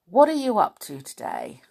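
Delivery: plain reading voice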